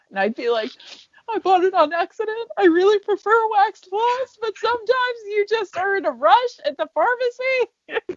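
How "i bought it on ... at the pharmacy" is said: high, prissy voice, pitched up; put-on character voice. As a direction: play a fussy, defensive woman. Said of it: "built out this character".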